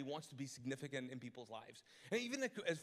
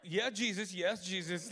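awkward loud amen; 3 semitones higher, 8 dB louder, slower than usual. Retold or imitated. Pastor caught in a clerk's prayer, loud and awkward. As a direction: play along while wishing to leave.

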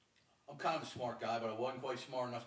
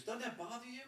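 higher, whiny voice of a frightened recruit; scared roommate. Retold or imitated. Imitating his crying roommate, in a high whiny voice. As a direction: play the scared roommate, pitched well up. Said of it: "He goes".

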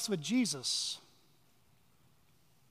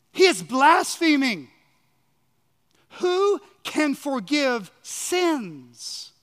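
raised, indignant accusation, drawing out the question; disgust and unbelief; 4 semitones higher, 4 dB louder, slower than usual. Acted. Scribes accusing Jesus of blasphemy, in disgust and unbelief. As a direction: act out an outraged, disbelieving charge. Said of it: "They said this in disgust and unbelief".

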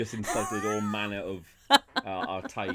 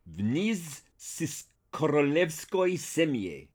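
slow, higher Russian line; 6 semitones higher, much slower than usual. Shown as a demonstration. Russian, announced for James. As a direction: lay it out slowly in Russian. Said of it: "you get the Russian".

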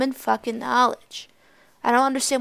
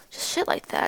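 usual speaking voice

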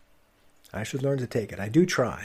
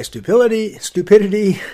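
exasperated; 7 semitones higher, 8 dB louder than usual. Acted. Swearing at his stupidity.

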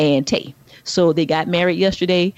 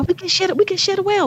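1 high playful protest; 12 semitones higher, faster than usual. Real, not acted. Protesting lottery sharing.

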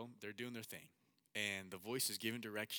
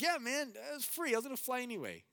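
high, loud and breezy; eager reassurance; 7 dB louder than usual. Retold or imitated. Pilot offering his friend a free flight, high eager reassurance. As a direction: wave off the worry, voice pitched up.

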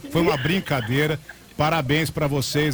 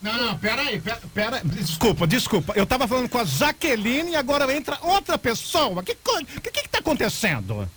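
a lightly acted flustered customer, higher; mock confused caller; 7 semitones higher than usual. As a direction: act fake confused. Prank caller faking confusion.

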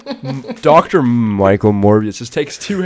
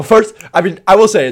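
usual speaking voice

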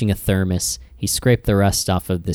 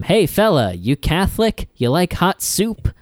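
higher-pitched mock yell at a passerby; imitated yell; 7 semitones higher than usual. Host quoting Frank hailing a passerby at his yard sale, mock yell. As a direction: call out like a hawker, without real volume. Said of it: "Frank yells at him".

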